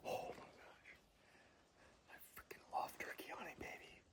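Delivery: fast, hushed whisper of pure excitement; thrilled whisper. Real, not acted. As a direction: whisper it fast, buzzing with joy. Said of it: "every hair on my body is standing up".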